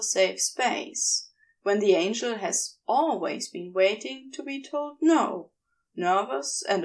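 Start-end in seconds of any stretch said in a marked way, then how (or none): none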